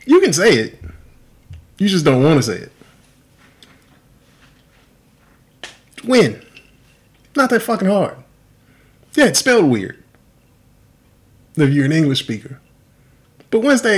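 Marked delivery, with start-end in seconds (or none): 0.0-10.0 higher and louder, fed up; exasperated. Real, not acted. Ranting to a friend about people mispronouncing names, exasperated. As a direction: insist it is easy, half-yelling.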